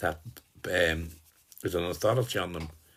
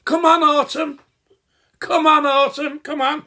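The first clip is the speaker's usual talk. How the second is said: loud, high yell across a beach; shouting; 11 dB louder than usual. Pretend. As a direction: bellow the name across a crowded beach. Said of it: "You shout".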